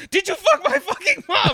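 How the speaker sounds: laughing mock yell. Retelling Charlie's line to Santa, laughing. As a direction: crack up mid-line while yelling it.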